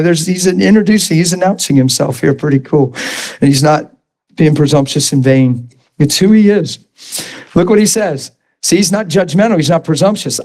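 normal talking voice

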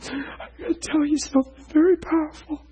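high, choked-up retelling of a crying friend; retold tearful words. Preacher retelling a weeping friend's news, high and choked. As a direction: let the voice catch like he's tearing up. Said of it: "He went".

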